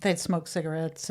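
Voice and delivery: ordinary talk, nothing marked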